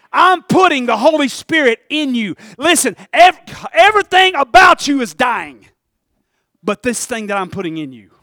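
loud pulpit preaching; rising sermon. Acted. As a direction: lift the crowd with each line. Preaching climb, louder.

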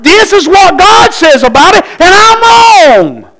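high, tearful cry of confession. Real, not acted. Preacher confessing before the congregation, tearful cry. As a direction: cry it out, breaking with feeling.

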